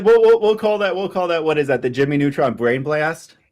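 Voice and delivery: loud, high, excited naming. Real, not acted. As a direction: burst out with it, thrilled.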